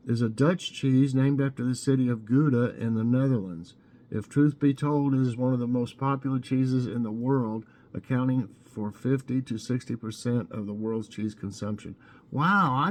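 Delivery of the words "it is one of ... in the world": ordinary talk, nothing marked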